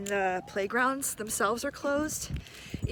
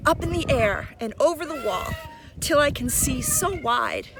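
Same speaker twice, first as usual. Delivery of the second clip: put-on lilting, sing-song reading of a children's poem; poem recitation; 3 semitones higher than usual. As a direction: recite it like a swing ride, rising and falling.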